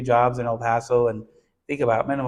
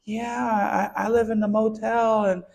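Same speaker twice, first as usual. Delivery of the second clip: put-on small boy; 10 semitones higher than usual. Boy voiced in retold story.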